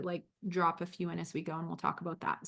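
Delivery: ordinary talk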